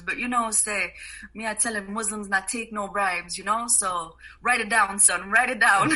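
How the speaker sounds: put-on a friendly Jamaican accent, firm by the end. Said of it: "he goes in the Jamaican accent".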